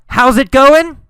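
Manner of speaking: high, loud calling voice; yelled. Shown as a demonstration. Teacher contrasting yelling with whispering, yelled. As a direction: shout the greeting to be heard far away. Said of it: "Did he yell".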